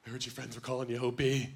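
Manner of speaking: soft, flirty half-whispered tease. Retold or imitated. Woman coaxing his nickname out, flirty whisper. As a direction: whisper to him seductively. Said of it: "she was like".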